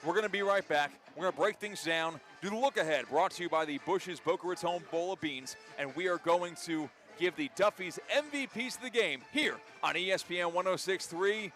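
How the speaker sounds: normal talking voice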